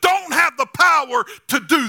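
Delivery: high, loud preaching climax; preaching shout. Real, not acted. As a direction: shout it to the church.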